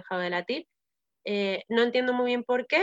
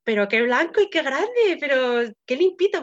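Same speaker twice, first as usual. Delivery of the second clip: put-on bright, louder gush of wonder at the baby; amazed delight; 5 semitones higher, 7 dB louder than usual. New mother seeing her baby, gushing with amazed delight. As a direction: marvel out loud at how big and clean he is. First sight of her newborn.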